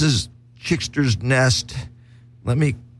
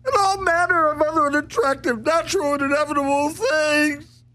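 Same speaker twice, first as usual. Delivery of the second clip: very high squeaky puppet voice, fast and frantic; frantic Chickster. Acted. The Chickster character raving he's insane. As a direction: shriek it like a crazed cartoon chick.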